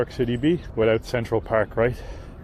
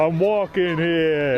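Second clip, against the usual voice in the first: slow, higher mock Italian American accent on I'm walking here; 5 semitones higher, slower than usual. Dublin visitor jokes as a New Yorker, mock Italian American accent. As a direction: ham up a New York Italian accent, stretched out. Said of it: "Somebody from Dublin doing a Italian accent".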